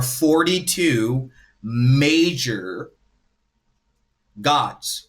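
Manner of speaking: "major" stretched out slowly and set apart by a pause; emphasis on major. Real, not acted. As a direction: draw out the word "major", then pause before "gods". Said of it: "emphasize the word major".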